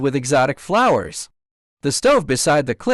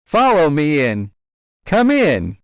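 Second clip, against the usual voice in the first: raised, slowly called-out command; moderate shout; 3 dB louder than usual. A narrator's reading. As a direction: call the others in with a restrained shout. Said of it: "and shouted".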